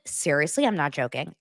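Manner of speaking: usual speaking voice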